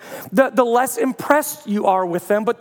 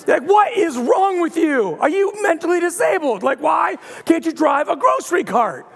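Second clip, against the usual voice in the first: hammed-up irritation at family; mock angry scolding; 6 semitones higher than usual. Shopper yelling at a relative's cart bump, mock angry.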